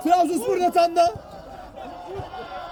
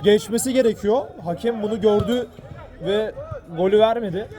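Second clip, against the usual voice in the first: ordinary delivery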